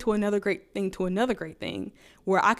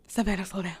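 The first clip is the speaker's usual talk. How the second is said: telling herself off; 4 dB quieter than usual. Retold or imitated. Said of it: "sometimes I'm like".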